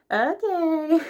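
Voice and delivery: high, bright mock-cheerful okay; chirpy assistant. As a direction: pop it up high like an eager assistant. Assistant answering the villain.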